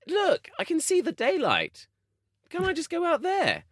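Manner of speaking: very high, drawn-out mock pleading; exasperated plea. Retelling his plea to the station guard, hammed-up exasperation.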